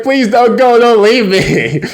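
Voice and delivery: high, drawn-out begging; high-pitched put-on plea; mock pleading. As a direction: beg desperately, hammed-up. Boy begs girl to stay.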